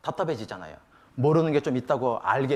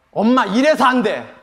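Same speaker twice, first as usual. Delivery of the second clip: slow, loud, raised mock reproach; scolding teenager; 7 semitones higher, 8 dB louder, slower than usual. High-schooler catches mother snooping, exasperated imitated scolding. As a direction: tell mother off, stretching the words.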